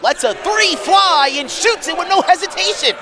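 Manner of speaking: high, excited call of the shot; real live sportscaster excitement; excited play-by-play. Real, not acted. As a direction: call the three as it flies. Live call of a three-pointer.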